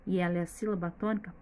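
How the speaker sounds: normal talking voice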